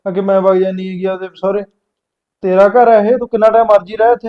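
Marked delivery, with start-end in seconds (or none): 2.4-4.3 insistent. Acted. Brother insists sister can stay.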